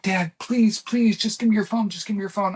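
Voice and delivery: frantic begging, acted out; retold panic.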